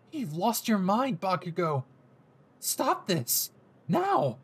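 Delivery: desperate hero; horrified Deku. Acted. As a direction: order him to stop.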